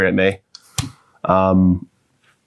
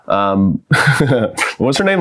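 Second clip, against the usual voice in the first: ordinary talk, nothing marked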